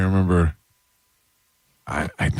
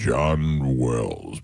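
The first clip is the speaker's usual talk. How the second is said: low, drawn-out big radio announcer voice; John Wells; 5 semitones lower than usual. Retold or imitated. Telling co-hosts about an old rock voice guy, big announcer voice. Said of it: "big voice".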